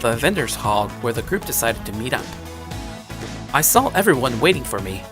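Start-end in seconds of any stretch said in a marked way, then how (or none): none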